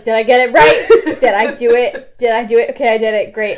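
loud, fast self-checking; fretting mid-dance; anxious self-check. Retold or imitated. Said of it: "you saw me just focus".

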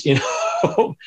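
normal talking voice

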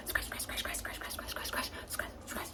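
quick run of whispered scratch, scratch; fast. Shown as a demonstration. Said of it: "Or fast".

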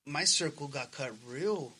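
ordinary talk, nothing marked

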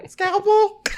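very high, loud mimicked New Jersey voice; mock New Jersey squeal; imitated New Jersey accent. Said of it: "Same voice every time".

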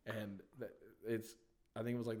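ordinary talk

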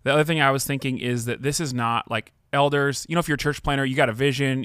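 ordinary talk

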